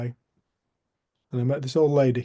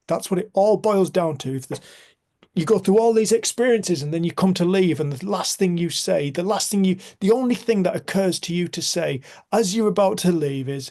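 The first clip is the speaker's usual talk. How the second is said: higher, louder, urgent retelling; impassioned insistence; 6 semitones higher, 6 dB louder than usual. Retold or imitated. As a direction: press the point with rising passion. Retelling his impassioned realisation.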